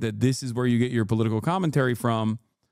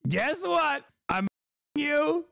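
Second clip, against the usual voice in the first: mock Trump voice; 9 semitones higher than usual. Mocking Trump speech voice.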